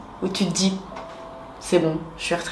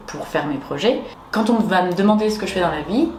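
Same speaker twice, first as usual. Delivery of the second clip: usual speaking voice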